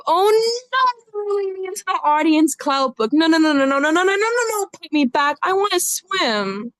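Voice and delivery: high, frantic string of no's; a hooked fish begging to be put back; panicked plea. Acted.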